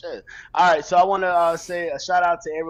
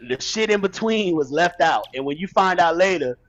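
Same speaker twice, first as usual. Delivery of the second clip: ordinary talk, nothing marked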